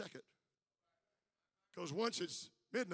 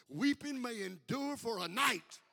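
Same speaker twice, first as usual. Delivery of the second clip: slow build to a huge shouted joy; preaching climax; 4 semitones higher, 14 dB louder, slower than usual. Acted. Preacher to congregation, slow swell to a shout. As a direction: preach it, rising to a roar.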